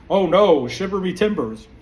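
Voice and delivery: loud, hammed-up pirate gasp of fake fear; sarcastic pretend terror, loud then easing; mock alarm. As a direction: scoff at the threat with fake panic.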